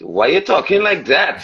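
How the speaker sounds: high, swooping Trini sing-song challenge; Trinidadian accent. Retold or imitated. Trini players to an American faking the accent, scornful Trinidadian lilt. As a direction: mimic a disapproving Trini, pitch leaping up. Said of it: "The Trini suck their teeth".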